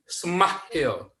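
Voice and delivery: "smile" slowly split, with a breathy h in the middle; transliterated English smile. Shown as a demonstration. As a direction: smile drawn out as se-mah-hil. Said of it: "baca tulisannya".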